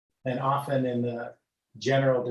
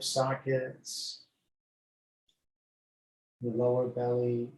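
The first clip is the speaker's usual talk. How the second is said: guided meditation. Acted. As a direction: lull them gently from toes upward. Leads body scan meditation.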